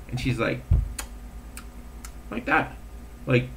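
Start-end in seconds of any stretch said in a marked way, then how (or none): none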